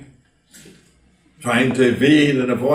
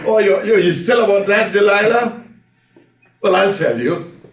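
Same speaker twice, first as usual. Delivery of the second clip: Samson brushing her off, higher and louder; teasing Samson; 7 semitones higher, 5 dB louder than usual. Acted. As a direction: play Samson laughing off his nagging lover. Samson teasing Delilah in story.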